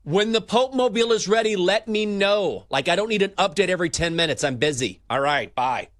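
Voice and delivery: high, loud busy executive brushing off calls; impatient office boss voice; annoyed boss on the phone. Pretend.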